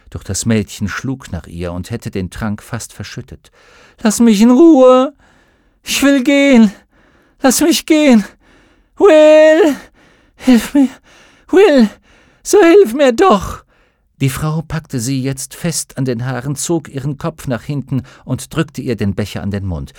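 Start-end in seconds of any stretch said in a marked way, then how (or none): 4.0-13.6 high, loud frantic screams; desperate screaming. A narrator's reading. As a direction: scream for help with all you have. Mädchen wehrt sich, schreit nach Will. (verzweifeltes Schreien)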